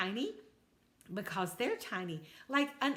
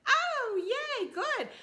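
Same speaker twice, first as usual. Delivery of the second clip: high, loud cheer; grandma cheering a happy little toad; excited; 5 dB louder, slower than usual. Acted. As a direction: squeal with delight for her.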